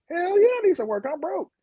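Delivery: high, eager street voice; eager yes. Retold or imitated. An undercover cop playing a broke ex-con, high and eager. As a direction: jump at the offer like a broke ex-con.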